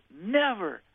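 high, falling retold cry; mock refusal. Tormented woman refusing to forgive her father, high imitated refusal.

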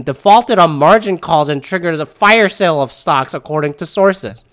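ordinary talk, nothing marked

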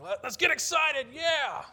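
very high, very loud hyped-up shout; exaggerated imitation of a pumped-up yell; mock hype vamping. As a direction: whip up the crowd like an over-eager worship leader. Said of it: "up there vamping".

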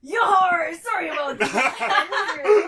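slow, laughing through the words; can barely get it out for laughing; laughing apology. Acted. Laughing in character.

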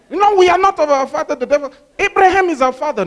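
high, loud, fast indignant retort; hammed-up outraged crowd yelling back; indignant Pharisees. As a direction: shout back in offended protest.

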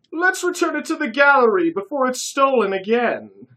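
high loud put-on British voice; fake British accent. A narrator's reading. Curtis leading them back to the gallery, fake British accent. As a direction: keep the toff accent going. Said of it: "said Curtis".

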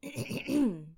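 clearing the throat. Retold or imitated. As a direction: clear throat before speaking. Clearing throat before call.

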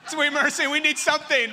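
high, loud flustered panic; hammed-up hostess scrambling to clean. Churchgoer panicking that the pastor is coming over, loud and high. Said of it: "panic feeling".

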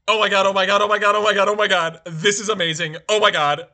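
loud, rapid string of gasped amazement; over-the-top mock hysterics, loud; hysterical fan gushing. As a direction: imitate someone losing it with excitement. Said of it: "was just in hysterics".

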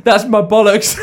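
loud, high-pitched mock protest; imitated shouting. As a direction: act out a panicked shout. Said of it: "Steve's just shouting".

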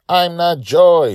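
put-on high, loud, slow little girl yelling; child's yell. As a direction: yell it back from the helm like a proud kid. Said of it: "she yelled back".

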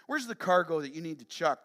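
usual speaking voice

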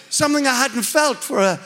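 normal talking voice

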